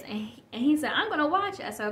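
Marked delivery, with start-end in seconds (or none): 0.9-1.6 much higher kid voice, lightly played; a little boy's high-pitched announcement, imitated; imitating eager son. Son telling mom he will watch the movie, imitated eager child. Retells son's decision.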